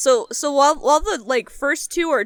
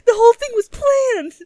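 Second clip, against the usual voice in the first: much higher and louder, emphatic; excited; 10 semitones higher, 5 dB louder than usual. Real, not acted. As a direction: stress planned with delight. Gleeful agreement, replaying the shock.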